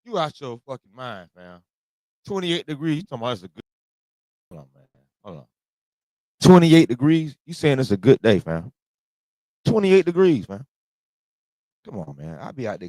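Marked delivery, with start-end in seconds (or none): 4.5-7.4 louder, disbelieving question; can't believe the number; incredulous. Real, not acted. Disbelief at good weather claim.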